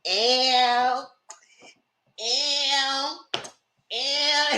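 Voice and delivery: high drawn-out "ew"; imitated stripper squeal. Stripper on her face, mock squealed ew. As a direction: stretch each word into a high little whine.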